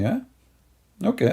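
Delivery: ordinary delivery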